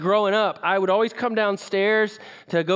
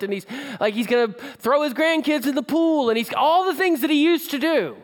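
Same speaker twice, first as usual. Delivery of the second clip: higher, building rush of hopeful promises; gushing, eager list, pitched up and emphatic; excited outpouring; 5 semitones higher than usual. Retold or imitated. Son picturing his dad's full healing, excited and insistent.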